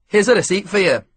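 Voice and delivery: chirpy Scottish mechanic handing over a receipt; Scottish accent. A narrator's reading. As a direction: lift the pitch with a Scottish lilt. Scottish ground worker talking.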